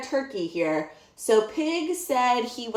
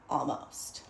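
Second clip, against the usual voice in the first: whispered almost; 11 semitones lower, 8 dB quieter than usual. A narrator's reading. Story punchline pause.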